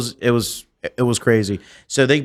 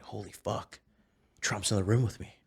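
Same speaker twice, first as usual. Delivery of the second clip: much softer, stunned aside; hushed awe; 12 dB quieter than usual. Retold or imitated. Impersonator alone after first try, hushed mock awe. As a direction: drop to an awestruck murmur. Retold awe at own impression.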